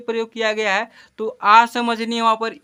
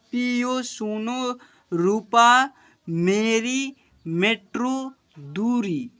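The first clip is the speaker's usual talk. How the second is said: slow, drawn-out syllables for pupils; slow reading aloud; 2 semitones higher, much slower than usual. Shown as a demonstration. Teacher reading slowly to children, drawn-out. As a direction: read each word out slowly. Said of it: "देखिए यहाँ पर क्या लिखा हुआ है".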